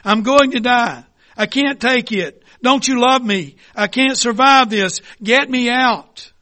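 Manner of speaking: loud, high panicked pleas; desperate cries for help from the furnace; screaming. Acted. Teacup in the furnace screams to the potter, panicked. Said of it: "I began screaming".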